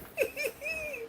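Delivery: soft high giggle, barely voiced; high laugh. Real, not acted. Giggling to herself at the chat.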